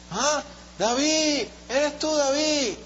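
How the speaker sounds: high, slow, drawn-out fond greeting; mock affection. Saul greeting David with feigned fondness, high and slow. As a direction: act as if delighted to see him. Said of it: "Como mostrándole como cariño".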